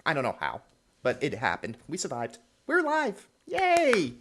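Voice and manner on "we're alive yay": high-pitched pretend celebration; hammed-up little hooray; mock cheer. Mock cheer at surviving apocalypse.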